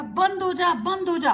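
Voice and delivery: firm shouted order; commanding. Acted. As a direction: order the stick to stop at once. Commands the magic stick stop.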